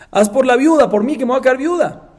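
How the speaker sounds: high, loud acted begging; desperate plea. Wife begging brother not to leave her widowed, pleading. Wife begs again in retold scene.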